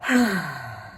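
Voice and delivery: long heavy acted sigh; loud sigh. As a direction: let out a big weary sigh. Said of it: "Mac's sigh was so loud".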